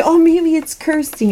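high, loud eager inner voice; bright hopeful little exclaim, pitched well up; eager hopeful thought. Retold or imitated. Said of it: "And there was Kirsty".

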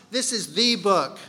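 "the" said as an emphatic THEE before "book"; marked "the", the one and only; stress on THE. Shown as a demonstration. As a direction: lean on "the" to single out the book. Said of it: "puts emphasis on that".

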